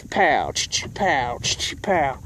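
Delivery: imitated BB gun firing and cocking; punchy "pow" with hissed cocking sounds; gunshot noises. Storyteller re-enacting shooting at bike thief, imitated gunfire. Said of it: "started shooting".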